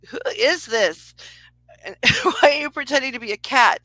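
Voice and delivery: laughing disbelief. Retold or imitated. Confronting the spirit pretending cat.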